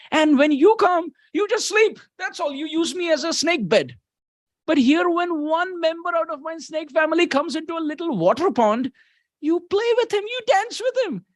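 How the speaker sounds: very high, aggrieved protest in character; Balaram. Acted. Balaram jealous that Krishna plays with another snake, very high-pitched protest. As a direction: pitch the complaint way up, playfully jealous. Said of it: "Balaram is thinking".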